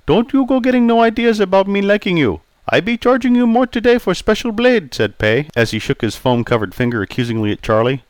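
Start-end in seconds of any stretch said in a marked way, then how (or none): 0.1-4.9 put-on raised, accented old barber voice; polite Chinese-accented barber greeting. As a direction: voice Pei the barber, courteous. Pei grumbles.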